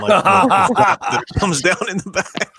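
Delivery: slow, high words breaking into laughs; laughing. Real, not acted. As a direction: crack up while saying it. Cracking up over butt flap.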